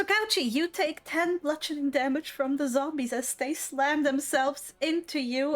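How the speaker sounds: ordinary talk, nothing marked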